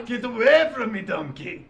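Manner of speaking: high, loud bad Scottish accent; failing Scottish ogre voice; Shrek impression. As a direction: call it out in wobbly mock Scots. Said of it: "I can't do a Scotch accent".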